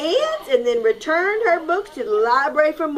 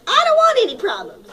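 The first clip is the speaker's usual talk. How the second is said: whiny hen; 11 semitones higher, 5 dB louder, faster than usual. Acted. Aunt hen refuses.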